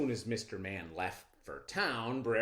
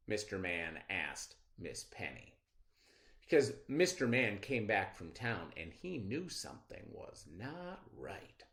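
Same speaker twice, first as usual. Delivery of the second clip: usual speaking voice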